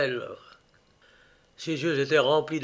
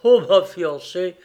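exclamation amère, forte et lente; étirer les mots avec un sourire amer; amertume; 3 semitones higher, 7 dB louder, slower than usual. A narrator's reading. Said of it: "avec un sourire amer". (ironie amère)

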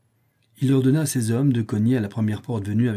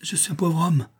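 high, pleading voice; timid, raised-pitch protest; frightened old man; 7 semitones higher than usual. A narrator's reading. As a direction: plead fearfully with armed men. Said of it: "tout tremblant de peur".

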